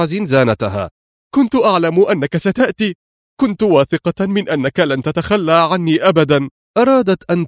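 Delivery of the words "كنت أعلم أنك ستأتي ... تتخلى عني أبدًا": put-on high, relieved young woman's voice; relieved heroine. Woman thanks her rescuer in a high, relieved voice. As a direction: she clings to her rescuer, grateful.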